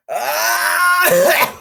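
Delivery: high, loud all-out scream; full scream. Pretend. Reader giving the scream everything, loud scream. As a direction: let out a huge scream. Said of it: "really give it my all?".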